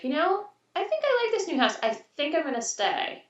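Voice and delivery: put-on high-pitched musing little-kid voice; thoughtful child. Mom quoting her son about the new house, thoughtful. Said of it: "Whitman said thoughtfully".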